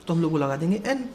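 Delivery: ordinary delivery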